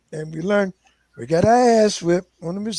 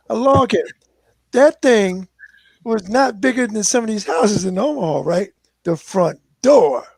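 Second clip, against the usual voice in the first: ordinary delivery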